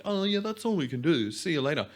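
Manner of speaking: retold offhand send-off; breezy dismissal. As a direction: imitate staff cheerily waving a patient away. Brushed off by hospital staff.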